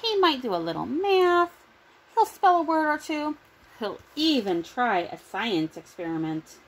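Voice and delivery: usual speaking voice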